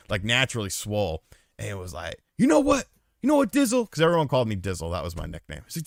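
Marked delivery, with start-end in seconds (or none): 2.4-3.9 high mock voice of a big stoner dude; imitating Daryl.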